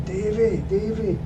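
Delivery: high-pitched imitation of someone yelling; mock shout. Owner's son calling Davey from the office, mock shout. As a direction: call out a name high without real volume. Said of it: "running out the office shouting".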